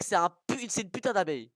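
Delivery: ordinary delivery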